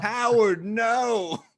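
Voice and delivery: high, loud cry of Howard, no; pleading shout. Retold or imitated. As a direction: shout him down from the crowd. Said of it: "they kept reusing that one line".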